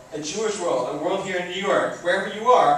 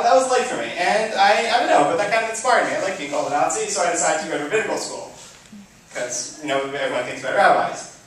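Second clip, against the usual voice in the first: ordinary talk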